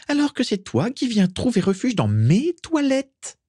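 very high, squeaky indignant complaint. A narrator's reading. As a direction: keep the high offended ghost-girl voice going. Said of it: "s'indigna Mimi". (plainte suraiguë et indignée)

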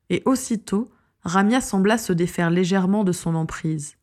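usual speaking voice

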